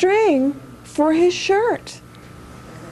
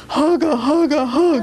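deep voice; 3 semitones lower than usual. Shown as a demonstration. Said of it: "Now with a deep voice you have to say".

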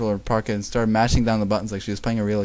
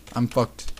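ordinary delivery

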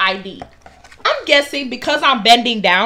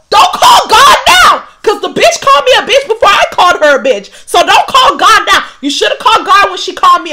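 high loud shout breaking into laughter; laughing, indignant yell; mock outraged shout; 9 semitones higher, 10 dB louder than usual. Real, not acted. Her furious reply, laughing.

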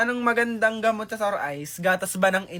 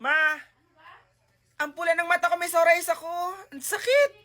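put-on whiny kid calling mom; 8 semitones higher than usual. Child with sore eyes calls mother.

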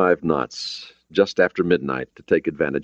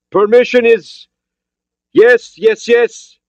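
put-on high, loud foreign-accented reply; accented shout; 8 dB louder, slower than usual. Borodin granting permission to board, halting accented shout. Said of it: "He had an accent, but his English was understandable".

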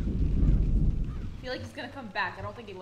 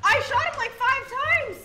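excited protest; 11 semitones higher, 6 dB louder than usual. Real, not acted. Defensive excited retort.

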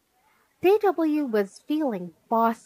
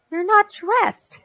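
put-on high, louder little-girl outburst; alarmed protest; 11 semitones higher, 7 dB louder than usual. A narrator's reading. Girl rushing into mom's office, alarmed protest. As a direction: accuse in an indignant child's voice.

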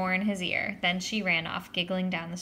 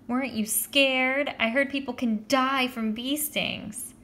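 put-on high, slow awestruck girl; drawn-out girlish concern; fawning girl; 5 semitones higher, slower than usual. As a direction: marvel at the brave boy. Judy fawns over Joey.

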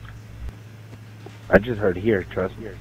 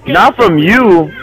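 slow, drawn-out exasperated protest; exasperated correction; 4 semitones higher, much slower than usual. Real, not acted. Player telling teammate protection is not from him, exasperated. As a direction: stretch out "you" with swooping pitch.

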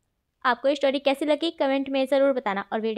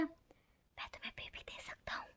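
whispered secret into his ear; 4 semitones higher, 23 dB quieter than usual. Acted. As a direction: breathe it close to the ear. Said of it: "कान में जाके बोलता है". (कान में फुसफुसाकर)

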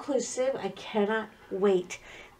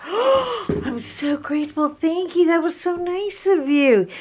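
gushing gratitude; 7 semitones higher, 3 dB louder than usual. Retold or imitated. Thanking her husband for the gift, high gushing delight. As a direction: gush like a delighted wife. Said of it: "And I was like".

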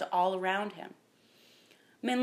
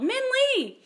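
very high, loud call; desperate shout for a lost child; 6 dB louder than usual. A narrator's reading. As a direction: cry the name out urgently. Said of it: "they called".

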